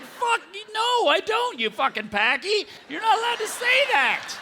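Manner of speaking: high, indignant comic protest; retold outrage. Passenger refusing to agree with the driver, outraged. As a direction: shoot back in high-pitched mock outrage. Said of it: "I'm like".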